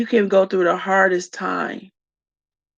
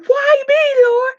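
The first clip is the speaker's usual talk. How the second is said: loud, very high acted wail; anguished cry to God; 5 dB louder, slower than usual. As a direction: cry out to the Lord in protest. Acts out crying out to God.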